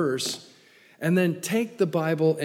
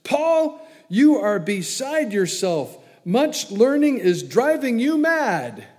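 shouting, higher and louder; 7 semitones higher, 6 dB louder, slower than usual. Acted. Governor Festus shouting at Paul in court, loud and high. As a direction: call Paul out as a madman. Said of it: "Festus said with a loud voice".

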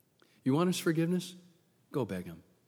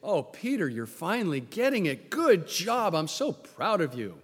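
imagined proud praise; 2 semitones higher than usual. Acted. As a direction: heap mock praise on a star pupil. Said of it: "Expecting Jesus to say".

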